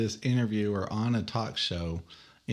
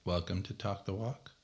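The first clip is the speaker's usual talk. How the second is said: softer, understated show welcome; low-key intro; 3 semitones lower, 9 dB quieter than usual. Shown as a demonstration. Host trying another way to open the show, low-key. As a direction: say "Welcome to Talk the Walk" quietly, lifting on "Walk". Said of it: "I already got three out of the way".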